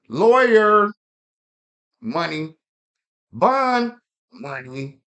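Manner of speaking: loud, high, each word hurled; defiant shout. Real, not acted. Man boasting police don't scare him, loud defiant shouting. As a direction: shout them out one by one.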